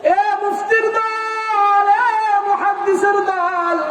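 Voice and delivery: high, slow drawn-out preacher call; preacher calling out to scholars; preaching cry. Real, not acted. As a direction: sing out each group to the crowd.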